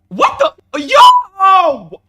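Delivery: high, loud alarmed yelling; startled scream. Real, not acted. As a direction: cry out in sudden fright. Alarmed as the chaser comes.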